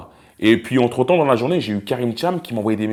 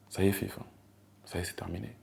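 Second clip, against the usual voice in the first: lightly voiced grave caller, much softer; voix grave imitée; 11 dB quieter, faster than usual. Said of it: "il a pris une voix grave et tout". (voix grave et basse, légèrement imitée)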